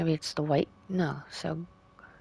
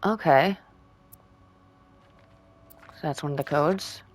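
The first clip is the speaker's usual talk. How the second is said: normal talking voice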